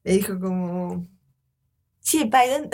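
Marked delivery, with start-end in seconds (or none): 2.0-2.7 high, louder attempt at an Argentine accent. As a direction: try an Argentine accent, badly. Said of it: "se iba a poner acento argentino". (intento de acento argentino, más agudo y fuerte)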